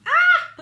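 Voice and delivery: very high, loud, giddy shriek; excited laughing squeal. Real, not acted. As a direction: let out a thrilled laughing squeal. Squeal after calling mom.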